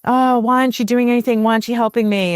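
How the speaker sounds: mock the fed-up woman in labor; hammed-up irritated plea; irritable laboring woman. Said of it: "They're like".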